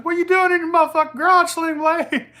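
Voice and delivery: very high, loud, fast mock yell at a buddy. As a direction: screech it in a joking fake outrage. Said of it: "I was like".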